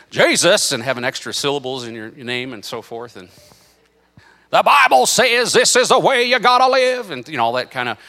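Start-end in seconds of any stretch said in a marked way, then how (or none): 0.1-0.7 Southern preacher accent, higher and louder. Shown as a demonstration. Preacher mocking the talk expected of cool preachers, exaggerated Southern accent. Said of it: "talking like this". 4.5-7.1 high, loud Southern fire-and-brimstone shout; Southern hellfire preacher. Acted. Preacher imitating old Southern guys, hellfire Southern shouting. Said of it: "hellfire and brimstone some of those Southern guys".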